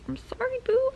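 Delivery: a cutesy squeaky apology; squeaky sorry. Real, not acted. Entschuldigung an die Zuschauer.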